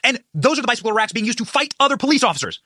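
ordinary talk

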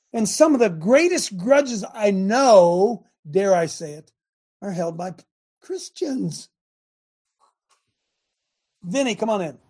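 ordinary talk, nothing marked

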